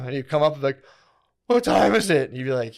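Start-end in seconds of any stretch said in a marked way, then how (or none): 1.5-2.3 higher, louder quick question; a lightly voiced brother checking in; brother asking. Brother comes up and asks the time: a touch higher and louder.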